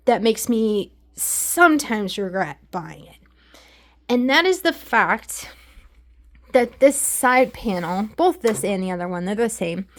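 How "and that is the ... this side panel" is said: ordinary talk, nothing marked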